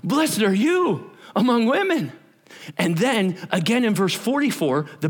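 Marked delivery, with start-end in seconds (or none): 0.0-2.1 Elizabeth's blessing. Retold or imitated. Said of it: "Elizabeth goes".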